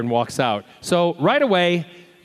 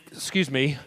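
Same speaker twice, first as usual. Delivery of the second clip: ordinary talk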